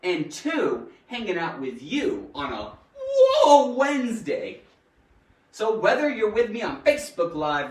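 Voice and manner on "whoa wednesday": drawn-out whoa with a laugh in it; big happy holler; excited whoop. Shown as a demonstration. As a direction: whoop the catchphrase playfully. Show's catchphrase called out.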